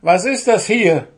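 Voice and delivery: high, loud indignant demand; vexed gnome confronting the culprit; annoyed. A narrator's reading. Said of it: "fragte Puh verärgert".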